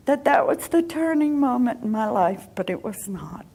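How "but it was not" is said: breathy whisper aside. Real, not acted. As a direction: whisper it ruefully. Whispered admission after laugh.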